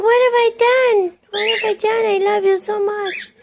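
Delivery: high, begging voice for the bird; pleading bird voice. Retold or imitated. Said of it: "He's like".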